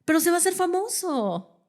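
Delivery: high-pitched naive protest; mock pleading parent. Mother arguing her son will be famous, mock pleading. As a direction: plead like a fame-struck mother.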